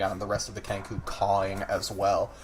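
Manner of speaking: usual speaking voice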